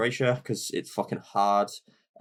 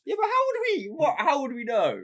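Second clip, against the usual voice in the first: incredulous. Real, not acted. Protesting the pronunciation mix-up.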